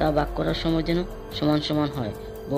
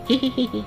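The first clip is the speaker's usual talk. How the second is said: sneaky giggle; 9 semitones higher than usual. Acted. Thief giggles stealing potatoes.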